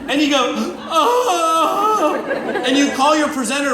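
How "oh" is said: high, loud fake sobbing "oh"; hammed-up crushed whimper; mock wail. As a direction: cry out in exaggerated dismay. Acts let-down after spouse's remark.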